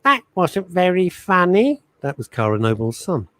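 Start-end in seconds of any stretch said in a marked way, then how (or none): 0.0-1.8 put-on high, slow little-boy voice; mimicked small boy's line. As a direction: imitate a small child, pitched up and drawn out. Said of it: "when he was a very, very little boy".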